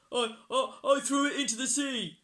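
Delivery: high, loud, stammering troll; frightened troll. A narrator's reading. Troll confesses to pirates, high loud stammer. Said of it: "said the troll".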